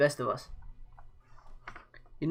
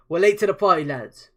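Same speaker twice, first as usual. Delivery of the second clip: loud, high battle cry to the lads; rallying call; 5 semitones higher, 10 dB louder than usual. Acted. Leader rallies his warband arriving at battle, loud call. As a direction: call out to the men with a grin.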